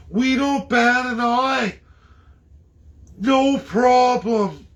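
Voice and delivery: high, slow, mock-breezy shrug; sarcastic nonchalance. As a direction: drip sarcasm, slowly, as if it's nothing. Sarcastic mock of easy acceptance.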